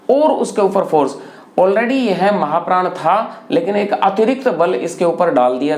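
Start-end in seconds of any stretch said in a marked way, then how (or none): none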